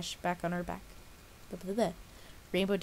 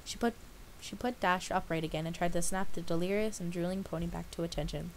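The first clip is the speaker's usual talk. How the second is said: ordinary delivery, nothing marked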